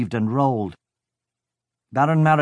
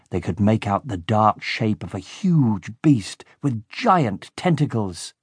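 ordinary delivery

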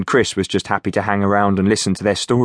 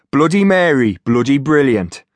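cheery, higher Geordie pitch; Geordie accent; 5 semitones higher, 3 dB louder than usual. Acted. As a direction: sell the drink, Geordie.

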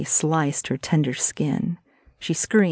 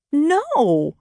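high-pitched cry of refusal; shouted refusal; 10 semitones higher, 3 dB louder than usual. A narrator's reading. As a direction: shout it high, only a little louder. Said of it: "she shouted".

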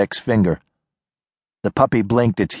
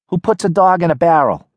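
put-on higher, guarded young woman; wary girl calling from a porch; tough city girl; 8 semitones higher than usual. Nadia voiced by narrator.

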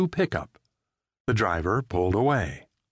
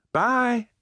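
put-on small boy's high cheerful bye; high-pitched child's farewell. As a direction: chirp it like a little kid. Child says goodbye to mailman.

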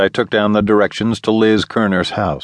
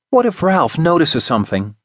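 demanding; 9 semitones higher than usual. Acted. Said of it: "she demanded".

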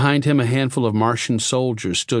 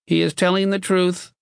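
high, monotone box voice with slight reluctance; reluctant machine; 11 semitones higher than usual. A narrator's reading. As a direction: concede it mechanically. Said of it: "it said reluctantly".

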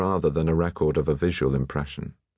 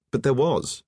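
higher-pitched eager correction; exclaiming; 7 semitones higher than usual. A narrator's reading. Alain correcting the painter about the grey, exclaiming. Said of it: "exclaimed Alain".

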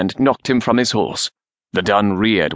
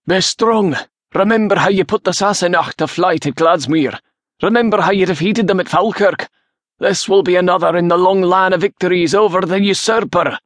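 high-pitched battle speech to the troops; rally the men with a raised, ringing voice; rousing exhortation; 8 semitones higher than usual. A narrator's reading. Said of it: "exhorting and encouraging the men".

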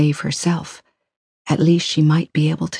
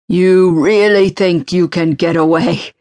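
slow, louder, gloating threat; menacing taunt; 4 dB louder than usual. Acted. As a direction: savor her fear, slowly. Villain taunts the trapped woman.

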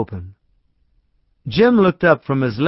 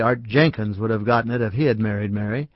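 ordinary delivery, nothing marked

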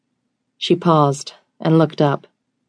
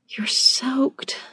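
high, whispery exhale; breathy whisper, awestruck; slow breath; 9 semitones higher, 6 dB quieter than usual. A narrator's reading. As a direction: let it out on a soft breath. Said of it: "she said in a slow breath".

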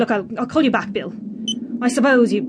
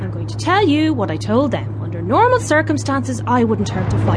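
usual speaking voice

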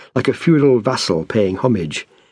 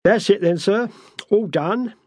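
much higher-pitched workman's voice; deferential foreman; 10 semitones higher than usual. A narrator's reading. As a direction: report to the boss, pitched right up, cap in hand. Foreman respectfully addresses the new owner.

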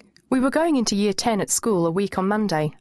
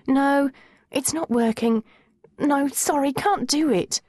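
high, whiny giving up; reluctant whine; 8 semitones higher, slower than usual. A narrator's reading. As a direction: give up plaintively, pitched up. Girl giving up on pricking herself.

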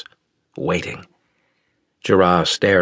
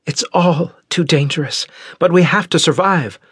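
put-on high, tense young voice; strained Osira; 10 semitones higher, 4 dB louder than usual. Osira answering Reynold, high and strained. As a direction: push through the worry, high.

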